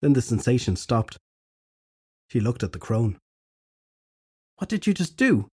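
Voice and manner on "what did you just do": high startled question; surprised. A narrator's reading. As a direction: ask in sudden surprise. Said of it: "surprised at the sound of her own voice".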